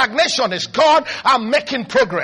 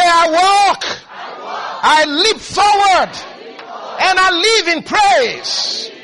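louder, slow shouted declaration; declaring loudly; 5 semitones higher, 4 dB louder, much slower than usual. Shown as a demonstration. Pastor leading a declaration for the congregation to repeat, shouted and slow. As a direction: proclaim it slowly for them to repeat.

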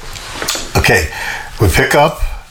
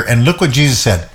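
usual speaking voice

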